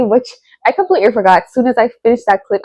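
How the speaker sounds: usual speaking voice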